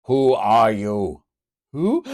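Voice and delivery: slow loud challenge. Acted. Native challenges Kitty.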